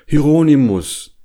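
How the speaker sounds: high, loud call of a name. A narrator's reading. Bear summoning his napping friend from the cave, calling.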